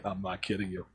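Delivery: low, hushed deadpan reply. Retold or imitated. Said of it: "I'm like".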